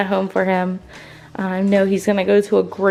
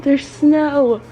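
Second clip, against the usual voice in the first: louder, higher excited cry; freaking out; 5 semitones higher, 3 dB louder, slower than usual. Real, not acted. Waking to snow in Louisiana, loud excited disbelief. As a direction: burst out with disbelieving joy. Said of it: "I'm freaking out right now".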